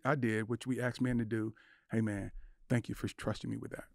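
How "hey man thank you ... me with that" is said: soft thanks. Retold or imitated. Retold words to friend, softer.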